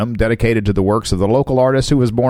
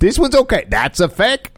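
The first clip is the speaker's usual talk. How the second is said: mock art expert; 10 semitones higher than usual.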